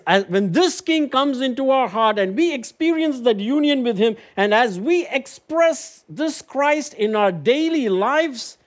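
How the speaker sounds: high, ringing, slow build to the amen; preaching at full force. Acted. Preacher to congregation, proclaiming Christ with rising fervour.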